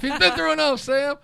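high, loud imitated protest; acted appeal to an attacker; mock plea. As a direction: plead over the commotion, hammed up.

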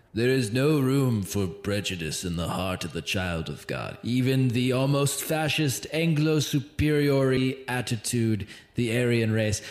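put-on voice of an older preacher's sermon, measured and subdued; Chuck Smith impression. Host re-quotes the church founder's sermon clip, imitating his voice. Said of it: "I'll do it in my Chuck voice".

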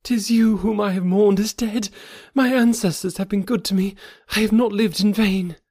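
a woman who thought her love was dead; overjoyed young woman. A narrator's reading. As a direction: Thuvia speaking through happy tears. Thuvia's voice, overjoyed.

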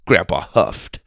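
usual speaking voice

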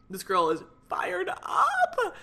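high, slow excited shout; fired up. Retold or imitated. A viewer watching her video, slow excited shouting. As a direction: hype her up like an onlooker. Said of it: "you'd be like".

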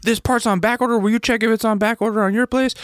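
high, pushy retold demand; livid demand. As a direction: push the question up high like you're fed up. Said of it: "now I'm livid".

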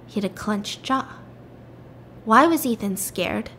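ordinary talk

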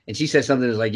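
ordinary delivery

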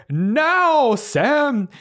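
high, loud battle cry; commanding shout. Acted. Mister Marrow signals Sam to cut the rope, loud shout. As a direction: yell the signal to drop it. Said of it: "Mister Marrow's like".

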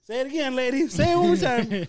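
high, loud mock gloat; retold triumphant gloating. Student egging on the teacher who praised him, gloating.

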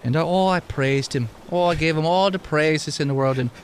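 put-on mildly Irish-tinged lilt for an old immortal NPC; Wisconsin Irish accent. GM voicing the immortal Tom to the players, light Irish brogue. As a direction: keep a light Irish brogue, wry and storytelling. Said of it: "He sounded like he was a Wisconsin Irishman".

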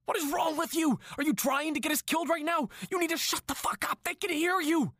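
high, frantic panicked yelling; panicked shout. Acted. Captor hushes screaming hostage.

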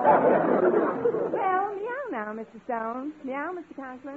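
drawn-out cowboy meow, pitched well up; Western drawl. Shown as a demonstration. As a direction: stretch it like a Texan saying goodbye. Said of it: "stretch it out with a little Western drawl".

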